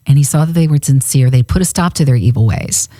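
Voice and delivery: ordinary talk, nothing marked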